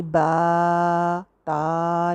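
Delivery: बा and ता with the aa dragged far too long; overlong alif. Shown as a demonstration. Teacher showing the overlong alif to avoid. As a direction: stretch the vowel too much, the wrong way. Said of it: "नहीं करना".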